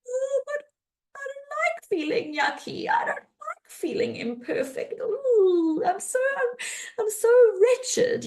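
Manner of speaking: high, whimpering mock cry; whiny vulnerable. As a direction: play the ashamed inner child, near tears. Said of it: "If I was honest when I felt shame, I would say".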